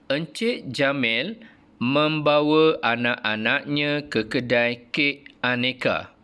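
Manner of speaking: slow, careful reading aloud for pupils; model reading intonation. Shown as a demonstration. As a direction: read it slowly with clear rises. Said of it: "mengikut intonasi yang cikgu ajar". (bacaan contoh dengan intonasi yang jelas)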